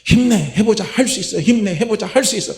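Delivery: rally yourself with a bright cheer; upbeat self-encouragement. Shown as a demonstration. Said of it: "혼잣말로 그렇게 하는 거예요".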